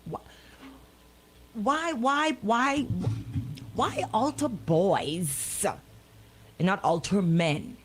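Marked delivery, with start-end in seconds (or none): none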